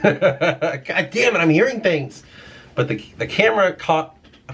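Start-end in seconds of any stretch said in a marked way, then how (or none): 0.0-2.2 laughing it off, high and slow; spooked but amused, words half laughed; nervous laugh. Real, not acted. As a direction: chuckle through your own jitters.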